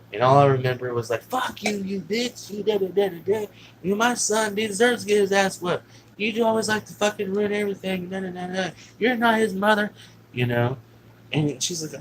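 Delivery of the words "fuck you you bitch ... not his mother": high-pitched mock ranting; huge argument. Dad berating stepmother over his son, retold ranting. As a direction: imitate a heated row, pitch held high. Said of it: "they had a huge argument".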